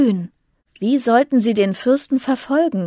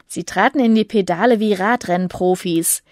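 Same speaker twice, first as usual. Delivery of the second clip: plain reading voice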